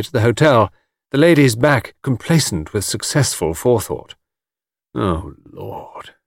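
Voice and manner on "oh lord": muttered. A narrator's reading. Anthony to himself as the Caldecotts leave, muttering. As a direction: mutter it wearily under the breath. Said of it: "muttered Anthony".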